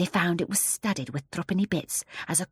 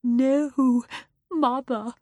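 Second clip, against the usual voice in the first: higher voice of a frightened daughter; trembling; 7 semitones higher, 5 dB louder, much slower than usual. A narrator's reading. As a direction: answer mother fearfully.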